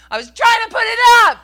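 very high, fast, full-volume shout; angry bellow. Retold or imitated. Son yelling back at his mom, furious bellow. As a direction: bellow it back at top pitch. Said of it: "got mad at me and yelled back".

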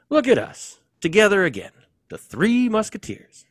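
high, loud celebratory voice; high, loud happy cheer; cheerful exclaim. Acted. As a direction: cheer the reunion brightly. [name] cheers the reunion.

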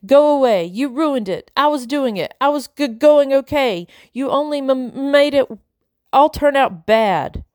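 high, upset voice close to tears; tearful. Acted. Ida sobs at Tumble against her grandmother. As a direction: cry out at her through welling tears. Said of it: "tears welling up in her eyes".

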